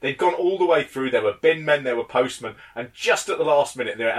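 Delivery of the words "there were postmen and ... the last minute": ordinary talk, nothing marked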